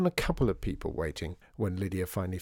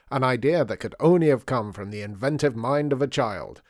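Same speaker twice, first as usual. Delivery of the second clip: reading aloud; 3 semitones higher, 5 dB louder than usual. A narrator's reading. Lydia reading the newspaper story to her father, reading aloud. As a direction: proudly proclaim it like a feature article. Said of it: "read Lydia aloud".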